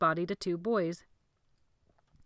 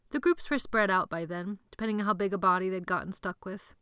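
ordinary delivery

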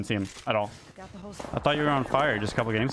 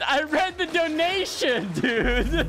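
very high, drawn-out indignant wail; mad protest; 13 semitones higher, much slower than usual. Real, not acted. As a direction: plead your innocence in outraged squeals. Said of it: "Look how mad he is".

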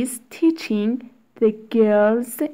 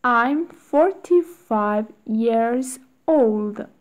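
slow, careful drill reading; slow model reading. Shown as a demonstration. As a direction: say it slowly for the class. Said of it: "teacher models drill slowly".

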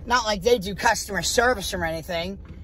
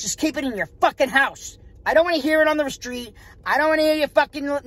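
heated real rant, higher pitched; pissed off; 5 semitones higher than usual. Real, not acted. Shopper ranting about asking product questions, pissed off. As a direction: let the anger push your voice up. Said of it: "That's pissing me off".

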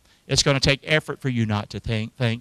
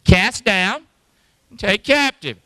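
louder, punchy chant of "Cast down and take captive"; rallying chant, louder; call and response; 6 semitones higher, 6 dB louder, slower than usual. Shown as a demonstration. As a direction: lead the congregation in saying it. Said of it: "Say that with me".

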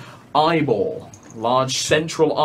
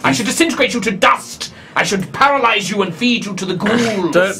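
furious threat; 6 semitones higher than usual. Acted. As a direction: rage at the doubter. God threatens the doubter.

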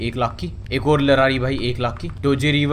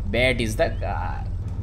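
"bet is the car" stretched out very slow; drawn out; 6 semitones lower, 5 dB quieter, much slower than usual. Shown as a demonstration. The gamer repeats his line very slowly to himself. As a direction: drag every word of "bet is the car".

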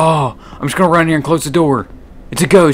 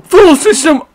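startled; 12 semitones higher, 5 dB louder than usual. Real, not acted. Startled by lockdown announcement.

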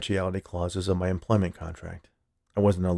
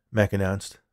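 plain reading voice

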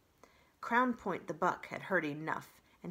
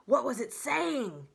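raised, higher storybook groundhog; lightly voiced groundhog calling out a question; 6 semitones higher, 7 dB louder than usual. A narrator's reading.

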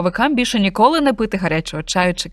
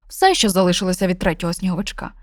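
ordinary delivery, nothing marked

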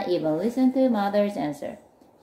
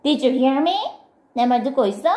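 high, raised scolding question; loud demand; 5 semitones higher, 4 dB louder, much faster than usual. A narrator's reading. Friend checking if Milton is listening, loudly. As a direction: demand attention, pitched up and louder. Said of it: "said Murgatroyd loudly".

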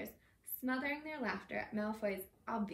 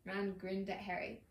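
ordinary delivery, nothing marked

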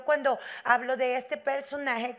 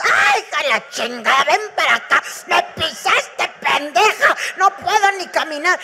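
loud, angry scolding shout, much louder; furious mother yelling; 3 semitones higher, 7 dB louder than usual. Retold or imitated. Mother screams at her daughter for stepping on her toe. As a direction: bellow at the kid who stepped on your toe.

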